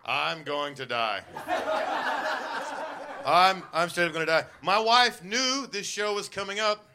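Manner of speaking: ordinary talk, nothing marked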